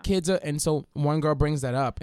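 ordinary talk, nothing marked

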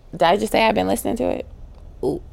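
ordinary talk, nothing marked

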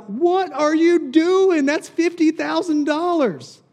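high, loud, slow outraged cry; a shocked dinner guest yelling at the waste; shocked outcry. Retold or imitated. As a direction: gasp it out in horror at the waste. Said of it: "you would be like".

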